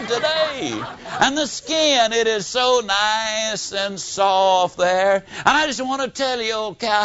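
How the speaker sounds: hammed-up loving pep talk to a cow; sweet-talking a cow. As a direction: lay on the flattery like courting her.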